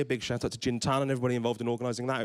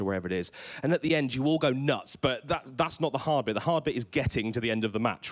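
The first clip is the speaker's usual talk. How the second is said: ordinary talk, nothing marked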